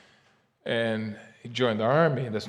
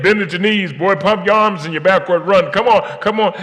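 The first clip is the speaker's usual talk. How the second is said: louder coach barking drills; coach shouting orders; 7 semitones higher, 11 dB louder than usual. Retold or imitated. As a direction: drive the boys like a coach. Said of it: "telling the young man".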